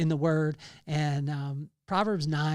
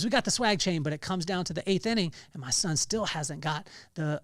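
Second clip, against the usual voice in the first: normal talking voice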